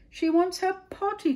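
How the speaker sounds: very high, loud shout; cried out. A narrator's reading. Admiral passing the call along, loud high cry. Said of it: "cried the admiral".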